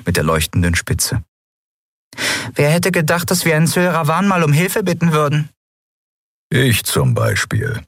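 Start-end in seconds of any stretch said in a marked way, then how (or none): 2.5-5.5 higher, quick and chirpy wry question; a light, fast little creature voice; small bird-like figure. A narrator's reading. Voices Nelen. 6.5-7.8 lower, slow gruff grumble; grumbling. A narrator's reading. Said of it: "brummte Keru".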